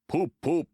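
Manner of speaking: loud poop poop; big engine whistling to the passengers; engine whistle. A narrator's reading. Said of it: "said Gordon".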